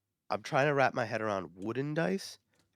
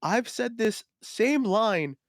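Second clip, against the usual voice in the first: emphatic pleading; 7 semitones higher, 4 dB louder than usual. Retold or imitated. Seller swearing honesty to a doubting customer, pleading emphatically. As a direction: hammer each phrase to convince them.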